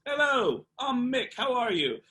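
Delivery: high, loud cheery [name] greeting; bright hammed-up "Hello, I'm Mick"; imitating [name]. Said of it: "and he goes".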